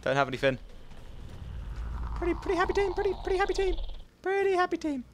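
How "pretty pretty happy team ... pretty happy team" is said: much higher, softer cutesy chant; silly squeaky voice. Pretend. Gamer cooing softly to teammates in high voice. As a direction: croon it like a toy.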